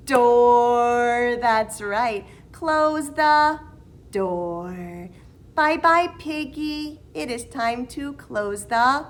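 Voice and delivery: singsong preschool teacher; reading to a toddler. Acted. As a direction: sing it out for little ones. Singsong circle time teacher.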